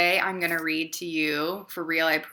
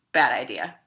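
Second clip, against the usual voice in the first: deadpan verdict; 5 semitones lower, 4 dB louder than usual. Acted. Deadpan refrain after each idea.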